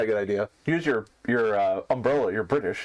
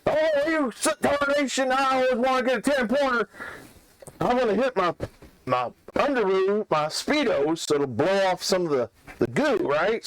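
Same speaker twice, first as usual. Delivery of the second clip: put-on high, drawling old prospector voice; 6 semitones higher than usual. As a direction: play a grizzled old prospector. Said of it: "So Bubba's like".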